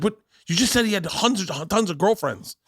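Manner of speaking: high-pitched, incredulous mock scolding; berating. Mother to daughter about dad's girlfriends, high berating. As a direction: imitate an outraged mother, voice climbing. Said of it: "berated her".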